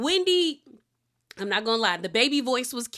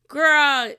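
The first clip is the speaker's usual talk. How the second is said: loud call-out; 6 semitones higher, 9 dB louder than usual. Real, not acted. Calling out to viewers before the funny scene, loud.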